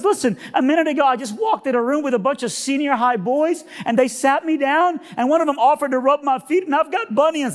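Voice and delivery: high-pitched, gushing woman's voice; high, amazed put-on voice; dumbfounded cleaning lady. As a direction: imitate an overwhelmed hotel cleaner, high. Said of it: "I'm sorry to be emotional".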